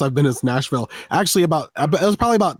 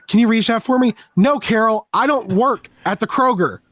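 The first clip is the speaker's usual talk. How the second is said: higher, put-on voice for the shopper asking and the tall clerk snapping back; mock shopper exchange; 8 semitones higher than usual. A tall guy mocking a shopper asking him to reach the shelf, in a higher put-on voice. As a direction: play both sides of a grocery-store exchange, a little higher.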